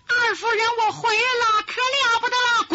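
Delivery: high, loud alarmed cry; breathless servant calling out in panic; alarmed maid. Acted. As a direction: burst in shouting the bad news. Maid bursts in with bad news.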